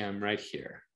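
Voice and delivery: quiet steady presence; calm reassurance. Shown as a demonstration. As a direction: soothe a child in a tantrum. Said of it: "you say".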